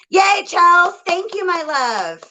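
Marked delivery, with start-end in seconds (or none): none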